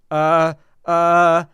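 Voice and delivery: loud, drawn-out mock "uh"; imitating stumped guy. Host mimics the guy lost for an answer, mocking stall. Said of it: "And he was like".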